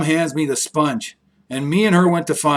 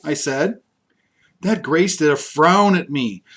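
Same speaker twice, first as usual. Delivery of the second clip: plain reading voice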